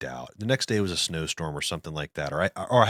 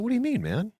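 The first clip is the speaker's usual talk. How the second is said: higher startled ask; alarmed high question. Retold or imitated. Startled reply to the neighbor's warning, higher. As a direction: squeak it in alarm.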